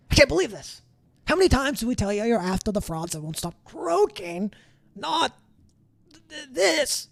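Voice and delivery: high, exasperated little guard voice; high scolding beet guard. Acted. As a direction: scold the two helpers in a squeaky voice. Guard fussing over prisoner.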